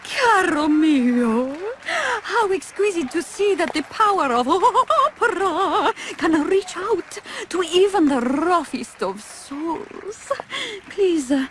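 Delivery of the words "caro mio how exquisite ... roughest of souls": gushing opera diva. Acted. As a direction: gush grandly like a prima donna.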